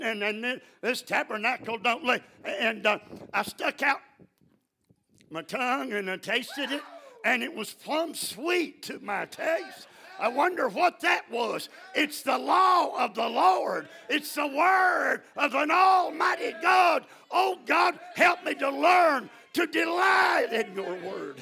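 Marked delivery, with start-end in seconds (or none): 3.3-11.7 excited wonder. Retold or imitated. Preacher tells of tasting sweetness. 11.9-21.4 loud, high, slow shouted preaching; full-force preaching. Acted. Preacher shouting praise to the congregation, loud and high.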